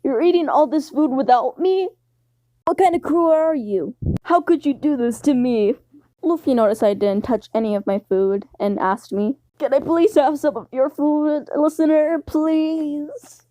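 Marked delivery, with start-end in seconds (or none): 0.0-5.7 a touch higher, hurt and whiny; whiny Luffy. Acted. Luffy whining at his crew for eating without him. 9.6-13.4 a touch higher, pleading, please drawn out; begging Luffy. Acted. Luffy begging the listener for food, pleading.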